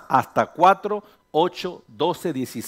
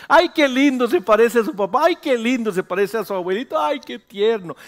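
gushing relative; 5 semitones higher than usual. Acted. Pastor mimicking relatives cooing over a baby's looks. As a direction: coo like a doting grandma.

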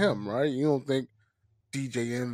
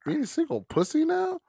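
incredulous; 12 semitones higher than usual. Retold or imitated. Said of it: "I was like".

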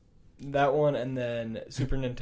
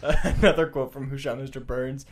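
normal talking voice

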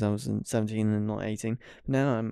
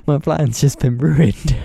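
louder, slow, laughing as the words come out; real laughing; 3 semitones higher, 10 dB louder, slower than usual. Real, not acted. Host realises the passcode must change, laughing. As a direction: laugh through the complaint. Laughing at own spoiled plan.

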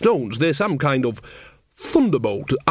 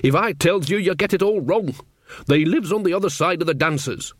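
higher-pitched, fretful rustic grumble; miserable; 5 semitones higher than usual. A narrator's reading. Mother fretting her son will misunderstand, mildly miserable. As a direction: complain with a touch of misery. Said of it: "said Nanny miserably".

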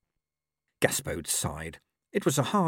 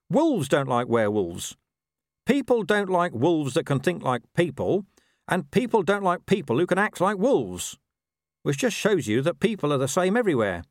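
high-pitched talking dog, worldly-wise; Gaspode, lecturing; 8 semitones higher, 4 dB louder than usual. Acted. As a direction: lay it out like a know-it-all mutt. Dog explains prejudice.

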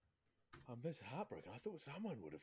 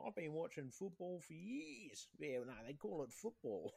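higher, slow smug fake fan voice; mock bandwagon fan; 8 semitones higher, slower than usual. Mocking fair-weather football fans, slow smug higher voice. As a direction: parody a know-it-all new fan.

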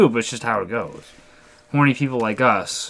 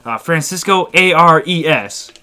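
higher, louder overfriendly stranger; moving-truck guy; 6 semitones higher, 5 dB louder than usual. Retold or imitated. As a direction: imitate a pushy guy handing out his contact. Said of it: "And then he was like".

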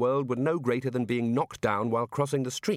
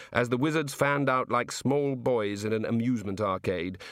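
plain reading voice

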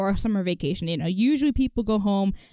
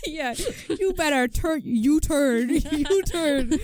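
laughing; 7 semitones higher, slower than usual. Pretend. Mock stern warning, laughing.

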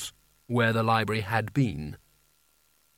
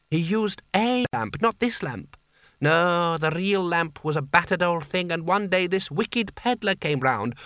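florid Seraph voice; 4 semitones higher than usual. Acted. The Seraph answering Kanina's plan, high and flowery. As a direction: flatter her lavishly while pointing out the flaw. Creosote tells the lamp's history.

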